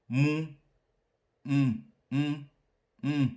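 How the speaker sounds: "Um" held on a high, unmarked first tone; high level tone. Shown as a demonstration. Teacher modelling "um" for learners, high and level. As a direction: sing "Um" out on a high level pitch. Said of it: "Um, le premier ton".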